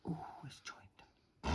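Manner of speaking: whispering on a held breath; holding breath. Real, not acted. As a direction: squeeze words out holding breath. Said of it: "Holding my breath".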